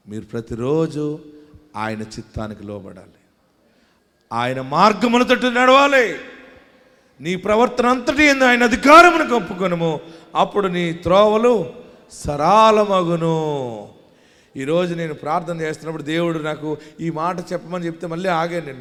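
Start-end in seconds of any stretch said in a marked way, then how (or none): 4.3-13.9 high, louder scripture proclamation; preacher proclaiming scripture with full force; fervent preaching. Acted. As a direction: declare the verse from the pulpit.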